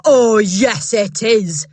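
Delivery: loud overbearing shout. Acted. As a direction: shout her down. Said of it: "shouted Miss Trunchbull".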